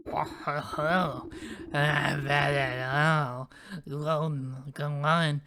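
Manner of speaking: voicing the old sow; gruff character read. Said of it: "so I don't have to voice you".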